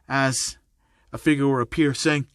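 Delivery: ordinary delivery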